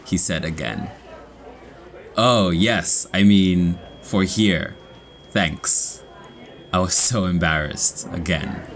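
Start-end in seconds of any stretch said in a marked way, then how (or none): none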